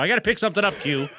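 high, hurried excuse; retold hurried brush-off. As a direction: toss it back while rushing past. Retelling a rushed brush-off in hallway.

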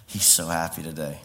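soft, low, contented wonder; tender adoration. Acted. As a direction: speak gently as a loving child about Dad. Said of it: "I come in from a place saying".

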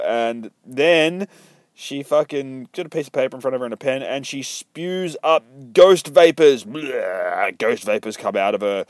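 normal talking voice

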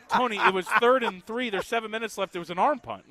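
mock protest, loud start easing off; exasperated viewer. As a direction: talk the announcer down from his hype. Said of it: "And we're like".

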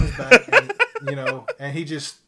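can barely get it out for laughing. Real, not acted. Laughing while telling the mishap.

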